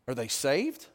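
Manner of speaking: ordinary talk, nothing marked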